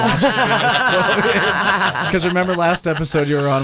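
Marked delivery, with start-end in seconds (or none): 0.0-2.1 high, laughing through the words. Real, not acted. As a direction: giggle while you say it. Laughing while teasing guest.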